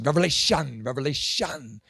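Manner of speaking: "Revelation" with the last syllable leaned on; teacher's pronunciation. Shown as a demonstration. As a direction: say "Revelation" firmly, ending cleanly with no S. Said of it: "My teacher said it's Revelation".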